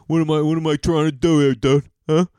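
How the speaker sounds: lightly voiced dismissive bro; imitated macho dude voice; mocking dudes. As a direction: put on a bro guy balking at the idea. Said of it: "how dudes are like".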